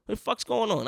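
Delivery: high-pitched, agitated bafflement; retold agitation. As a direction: act out worked-up disbelief, pitch climbing. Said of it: "I was really agitated at that point".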